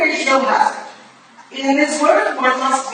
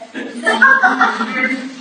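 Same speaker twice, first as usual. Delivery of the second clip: amused laugh; 3 semitones higher than usual. Acted. Modest laugh at praise.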